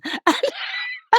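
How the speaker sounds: a high squeal of a laugh; delighted high laugh. Real, not acted. The lady says she's from Navan.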